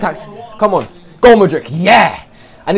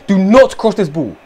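ordinary talk

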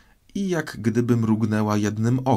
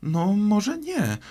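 high, slow, placating reply; drawn-out peacemaking tone; conciliatory; 12 semitones higher, slower than usual. A narrator's reading. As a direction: concede slowly in a high girlish voice. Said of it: "odrzekła Alicja pojednawczo".

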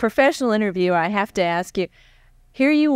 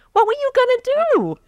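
sudden much higher, faster, theatrical delivery; dramatic question; faster than usual. Acted. Interviewer springs his one big question on the astronaut, high and theatrical. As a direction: deliver the punchline question with mock suspense.

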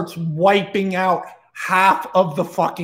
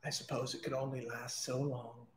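very soft, low devil murmur; quiet, low villain voice; resigned devil voice; 7 semitones lower, 22 dB quieter than usual. Acted. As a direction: let the mask drop, hushed and low. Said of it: "only for the devil to go".

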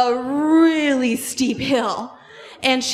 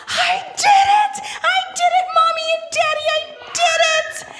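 put-on very high, elated child's shout; triumphant squeal; 14 semitones higher, faster than usual. Girl calls to Mommy and Daddy, triumphant squeal.

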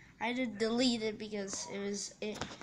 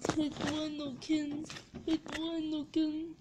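higher mock catchphrase voice; silly put-on voice; 7 semitones higher, 3 dB louder than usual. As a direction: toss it off in a goofy voice. Repeats catchphrase in a voice.

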